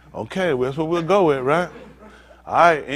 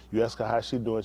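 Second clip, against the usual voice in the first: ordinary talk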